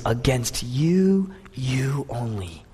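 acted tearful prayer, drawn-out words; David weeping. David confessing his sin to God, weeping. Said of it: "David is weeping".

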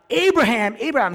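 loud, high urgent call to Abraham. Acted. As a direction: cry the name out from afar. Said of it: "This is Jesus calling out".

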